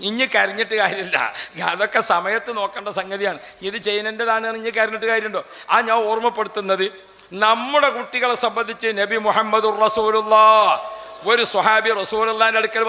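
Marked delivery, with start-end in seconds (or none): none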